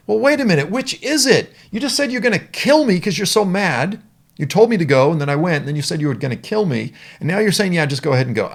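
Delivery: high, loud, baffled protest; Balaam protesting the angel's mixed orders; exasperated Balaam. Acted. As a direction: throw up your hands, who's right. Balaam voiced, confused and indignant.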